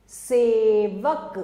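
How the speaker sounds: सेवक drawn out slowly and loud; slow, loud teaching of सेवक; slow demo word. Shown as a demonstration. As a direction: sound out सेवक slowly for pupils. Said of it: "ए".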